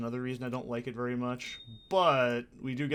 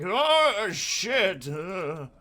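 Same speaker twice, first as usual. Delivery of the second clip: mock over-excited outburst, high and loud; hammed-up excitement with gasps and a cheer; Deckard Cain; 7 semitones higher, 7 dB louder, much slower than usual. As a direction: imitate someone getting way too excited. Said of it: "way too excited about it".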